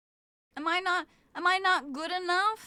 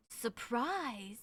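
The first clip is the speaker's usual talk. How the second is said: soft low puzzled "Surprise?"; quiet puzzled echo; 3 semitones lower, 7 dB quieter than usual. Shown as a demonstration. Streamer echoing a chat word, quiet puzzled. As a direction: murmur it to yourself. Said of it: "repeats it loud".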